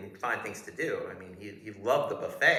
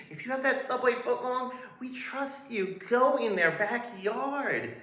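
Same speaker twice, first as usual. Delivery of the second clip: fawning guard voice; 11 semitones higher, 5 dB louder than usual. Retold or imitated. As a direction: gush like an overeager doorman. Guard voice again, welcoming.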